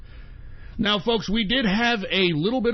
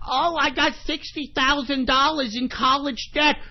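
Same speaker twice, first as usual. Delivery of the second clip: mocking whiny millennial; 5 semitones higher than usual. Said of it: "millennials are bitching and moaning".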